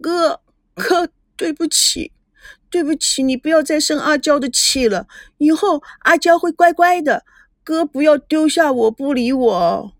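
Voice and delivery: high, acted crying apology; tearful pleading. A narrator's reading. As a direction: beg like a crying little sister. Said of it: "她哭着跑向".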